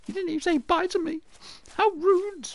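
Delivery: a squeaky high, sulky voice for being left without a goodbye; mock hurt whine. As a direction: act wounded in a squeaky high voice. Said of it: "And I'll be like".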